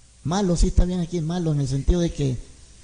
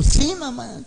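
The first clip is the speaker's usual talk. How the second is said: put-on insistent child; 8 semitones higher, much slower than usual. Niño insiste.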